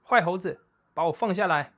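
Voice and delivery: high, loud cry for help; shouting. A narrator's reading. Boy thrown between monkeys, loud high shout. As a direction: yell it high and loud while being tossed. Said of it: "毛利大叫".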